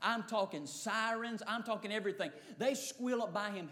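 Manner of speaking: normal talking voice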